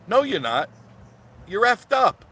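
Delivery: high, loud dismissal; worked-up contradiction; exasperated rebuttal. Real, not acted. Rebutting an imagined claim.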